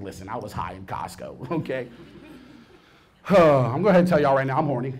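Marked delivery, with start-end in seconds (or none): 0.0-1.9 low, quiet confession. Retold or imitated. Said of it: "I'm like".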